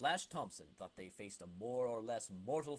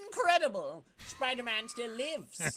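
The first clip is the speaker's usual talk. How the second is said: high, loud dramatic title call; announcer caption; 10 semitones higher, 5 dB louder than usual. Acted. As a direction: proclaim it like a comic splash page.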